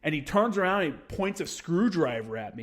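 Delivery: usual speaking voice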